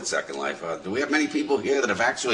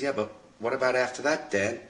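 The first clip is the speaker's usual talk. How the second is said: lightly voiced son, a little higher and softer; a hint of a boy pressing for more; questioning son; 4 semitones higher, 9 dB quieter than usual. Son asks dad what comes after, lightly voiced questioning son. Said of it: "Son says".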